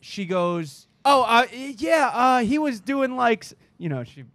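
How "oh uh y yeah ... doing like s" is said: high, flustered, stammering voice. Retold or imitated. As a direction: stumble like you're caught lying. Girlfriend quoted stammering.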